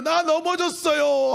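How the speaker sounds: high, slow plea of a fallen lamb; crying lost sheep. Acted. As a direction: cry out like a frightened lost sheep. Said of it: "하고 우는 거예요". (넘어진 양처럼 높게 애원하듯)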